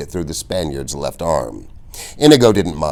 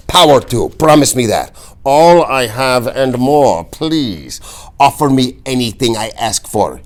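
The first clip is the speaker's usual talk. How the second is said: raised, pressing demand; 6 semitones higher, 4 dB louder than usual. A narrator's reading. Inigo forcing the Count to beg, raised and pressing. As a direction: force the bargain from him.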